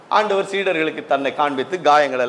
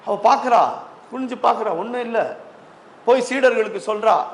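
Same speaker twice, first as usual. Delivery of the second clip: usual speaking voice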